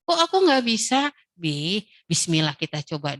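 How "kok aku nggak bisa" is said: put-on higher child's voice asking why not; whiny child. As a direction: play the frustrated little kid. Child complaining, voiced by mother.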